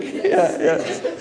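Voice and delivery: usual speaking voice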